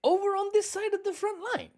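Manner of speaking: very high, mocking falsetto reporter voice; mocking reporter impression. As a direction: ham up the reporter's intro line in a squeaky falsetto. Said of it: "started with the intro phrase".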